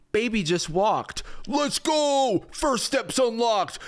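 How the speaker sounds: high, gushing hype voice; over-the-top celebration; hyped-up chatbot. Acted. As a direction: cheer it like a huge win, drawn out. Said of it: "G P T four oh".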